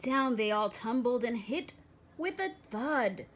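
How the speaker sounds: plain reading voice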